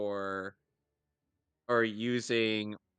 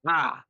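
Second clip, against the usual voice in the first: yelp of pain; 7 semitones higher, 7 dB louder than usual. Real, not acted. Got hit by drop kick.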